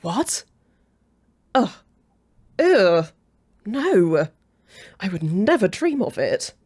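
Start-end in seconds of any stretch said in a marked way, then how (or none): 1.5-6.5 loud, revolted outburst; disgusted refusal. Acted. Rival asked about cuddling the other, revolted. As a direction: recoil with swooping, emphatic disgust.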